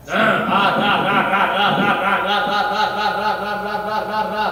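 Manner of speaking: pulsing syllable chant, fading; praying in tongues. Real, not acted. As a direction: start hard, then let the syllables ease off. (लयबद्ध अन्य भाषा का जाप)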